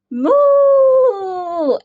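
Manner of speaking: high, loud drawn-out moo; cow's moo. A narrator's reading. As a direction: moo back like a wary cow. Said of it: "answered Belinda".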